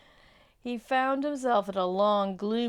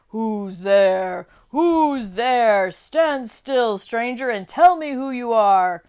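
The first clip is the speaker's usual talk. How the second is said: loud, snarling wolf voice; howling growling wolf; 3 semitones higher, 12 dB louder, slower than usual. Acted. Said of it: "said in a howling, growling voice".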